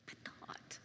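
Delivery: barely audible whisper; hushed hurt. Retold or imitated. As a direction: whisper it, letting the thought hang. Recalling hurt over forgotten gift.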